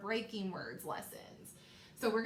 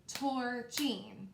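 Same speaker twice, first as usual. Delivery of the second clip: "Torching" in two separated, stretched syllables; syllables clapped out. Shown as a demonstration. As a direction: clap out "Torching" as tor, then ching. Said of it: "Let's clap it out".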